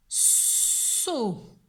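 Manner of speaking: "So" with a very long hissed s; sounding out. Shown as a demonstration. Teacher dictates second syllable to pupil, "So" stretched. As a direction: stretch "So" as sssso. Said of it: "écoute bien le premier son".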